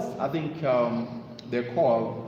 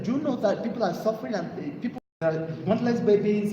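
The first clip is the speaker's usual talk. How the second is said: scolding; 6 semitones higher than usual. Retold or imitated. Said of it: "scolding her".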